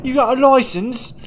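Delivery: high, loud comic Cockney; Cockney accent. Pretend. Streamer repeating the license joke, loud mock Cockney accent. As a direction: push it up into a loud Cockney caricature. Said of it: "older Cockney".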